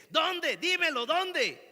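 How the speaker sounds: shouted high, insistent demand; sarcastic taunt. Retold or imitated. Preacher demands an answer from the grave, shouted taunt. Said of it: "le pregunta de manera sarcástica".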